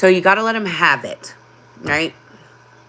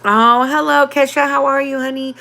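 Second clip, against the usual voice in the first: bright, much higher cheerful hello; light up to greet a friend; happy greeting; 9 semitones higher than usual. Real, not acted. Greeting a viewer joining live.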